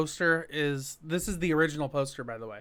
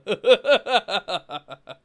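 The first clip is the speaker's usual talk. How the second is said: loud, put-on cackle in a burst; repeated signature laugh; 4 semitones higher, 7 dB louder than usual. Shown as a demonstration. Co-host showing the doll's laugh, loud mock cackle. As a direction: redo your own laugh, hammed up and higher. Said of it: "the same laugh that I just did".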